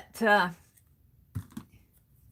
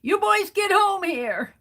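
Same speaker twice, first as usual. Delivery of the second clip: higher, louder retold holler across the alley; a neighbor's dad hollering his boys home; mock dad yell; 9 semitones higher, 3 dB louder than usual. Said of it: "their dad across the alley yelling at 'em".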